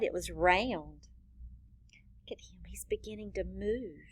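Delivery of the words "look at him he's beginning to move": fast hushed whisper; excited whisper. Shown as a demonstration. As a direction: whisper quickly as if not to disturb him. Excited whisper about the picture.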